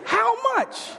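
squeaky shocked how much. Retold or imitated. Mocks shock at tiny portion.

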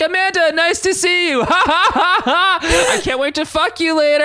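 high, loud forced laughter through words; nervous fake laugh. Kurt covering panic to Amanda, high forced laughing. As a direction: cackle nervously like a bad liar. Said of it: "he's like".

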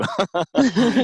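a big, high burst of laughter; loud laugh. Real, not acted. Laughing at own quote.

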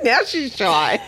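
amused, laugh-filled jab; laughing tease. Real, not acted. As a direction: tease her while laughing. Teasing amid laughter.

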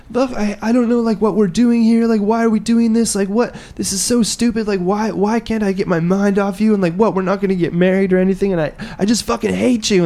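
high, worked-up mock crying voice; hammed-up distraught girlfriend; imitating bawling. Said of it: "she's, like, crying, like, bawling her fucking eyes out".

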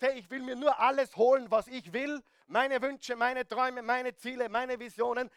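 selfish demand. Retold or imitated. Mocks a selfish spouse's demand.

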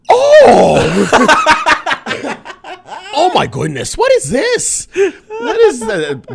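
very high, loud, panicked mom voice; impression of mom. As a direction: shriek it like a startled mother. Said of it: "the worst impression of my mom ever".

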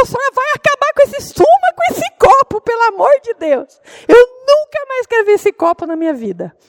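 very high, loud frantic pleading; hammed-up panicked cry; desperate outburst. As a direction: beg wildly to be rid of it. Acts desperate plea about cup.

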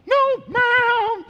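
put-on high, squealed scared-little-boy voice; mock-terrified high wail; scared to death. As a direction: squeak it like a frightened kid, hammed up. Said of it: "Man, I'm scared to death".

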